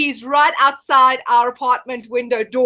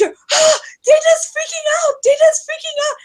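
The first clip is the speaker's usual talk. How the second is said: high, loud, crying mock panic; panicky wail; 3 dB louder than usual. Mother-in-law running from bees, high wailing panic. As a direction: wail it as you flee. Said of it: "then she just".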